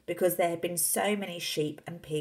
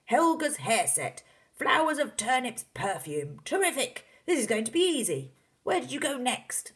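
excited father; 9 semitones higher, 5 dB louder than usual. Acted. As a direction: relish each find, then exclaim. Excited father lists the finds.

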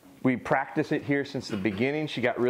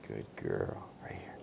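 low, much softer, whispered; soft whisper praise; 12 dB quieter, slower than usual. Real, not acted. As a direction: keep it hushed and calm. Soft praise while dog holds.

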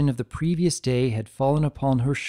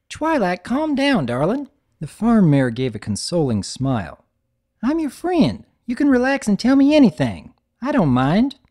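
calm a scared friend; soothing Applejack; 8 semitones higher, 5 dB louder than usual. Acted. Applejack consoling.

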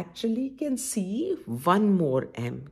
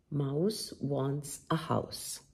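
storybook reading; 6 semitones lower, 7 dB quieter, slower than usual. Acted. Reads the book aloud.

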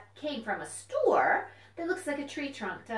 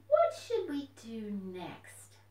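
normal talking voice

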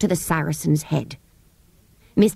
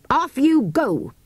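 slow, stern send-off; curt dismissal, dragged out; stern shopkeeper shooing children away; brief and stern; 8 semitones higher, slower than usual. A narrator's reading. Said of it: "brief and stern".

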